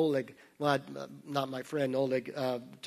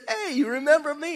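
higher, brighter-pitched retold greeting, lightly acted; cheery greeting; 11 semitones higher, 5 dB louder than usual. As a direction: call out to an old acquaintance. Retold joke, guy greets.

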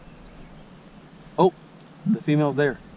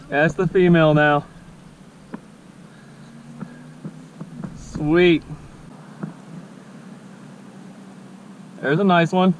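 higher and louder, still buzzing; excited; 6 semitones higher, 4 dB louder, slower than usual. Real, not acted. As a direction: admire the fish with delight. Admiring caught fish.

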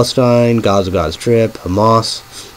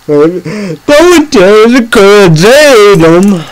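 quoted warning; 9 semitones higher, 6 dB louder, much slower than usual. Retold or imitated. Quoting someone's warning.

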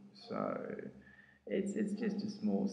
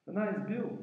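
much higher, different voice for the New York reporter; imitated journalist; 9 semitones higher, 6 dB louder, slower than usual. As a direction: put on the newsman's voice. Journalist introduces himself.

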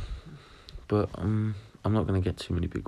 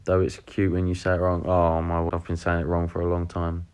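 normal talking voice

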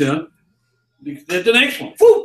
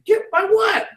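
high-pitched puzzlement. Retold or imitated. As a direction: imitate a baffled customer, voice way up. Passer-by puzzled by the offer.

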